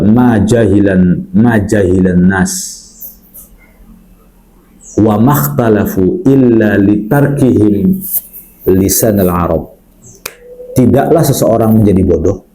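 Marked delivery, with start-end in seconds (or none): 0.1-9.8 slow Arabic recitation. Acted. Recites an Arabic saying slowly.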